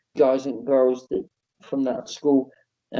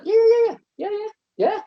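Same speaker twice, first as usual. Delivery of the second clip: squeaky, very high string of yeahs; panicked yeses; 4 dB louder than usual. Retold or imitated. Wrestler agreeing in panic, squeaky and very high. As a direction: fake calm, squeaking yes yes. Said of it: "I was like".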